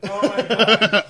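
laughing. Real, not acted. First bite of hot pepper.

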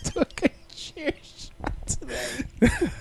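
high slow echo. Pretend. Echoing the bit, high slow.

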